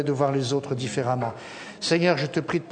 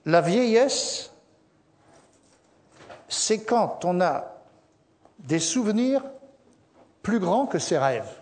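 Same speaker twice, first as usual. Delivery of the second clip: slow quote; 3 semitones higher, slower than usual. Shown as a demonstration. Quoting a saying slowly.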